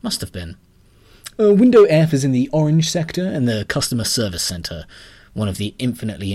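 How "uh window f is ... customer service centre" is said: higher, louder interchangeable clerk; a helpful bank employee chimes in. A narrator's reading. Narrator voices the second clerk, high and loud.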